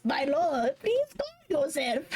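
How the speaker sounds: high, drawn-out gremlin plea; tiny sidekick begging his master; silly little voice. Retold or imitated. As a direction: mimic a servile little imp. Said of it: "to make a silly little voice to".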